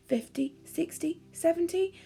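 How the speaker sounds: ordinary delivery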